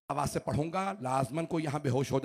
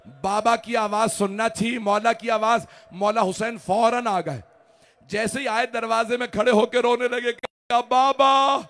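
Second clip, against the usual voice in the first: full-force majlis preaching; 10 semitones higher, 14 dB louder than usual. Real, not acted. Preacher pouring the grief of the martyrdom over the mourners, loud and high. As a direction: cry out the mourning lines to the whole gathering.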